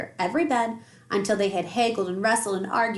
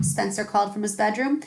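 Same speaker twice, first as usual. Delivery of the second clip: ordinary delivery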